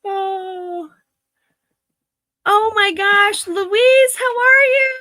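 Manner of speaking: tearful joyful hello to Louise; overjoyed greeting. Real, not acted. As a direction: greet a dear friend with a breaking voice. Greeting a missed friend.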